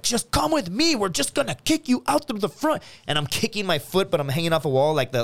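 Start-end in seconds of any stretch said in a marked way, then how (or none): 0.0-2.8 high, far-off calm order; bouncer voice. Retold or imitated. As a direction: coax him off the wall. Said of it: "they're like".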